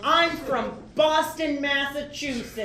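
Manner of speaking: brash Boston tourist voice; Boston accent. Pretend. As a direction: play up the Boston twang. Plays Boston tourist Jack.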